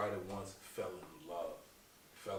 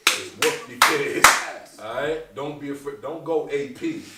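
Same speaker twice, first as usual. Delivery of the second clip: slow, much louder; emphatic declaration; 6 semitones higher, 16 dB louder, slower than usual. Real, not acted. Comedian declaring the interview's message, loud and slow. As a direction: proclaim it slowly like a message. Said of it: "the message of the whole interview".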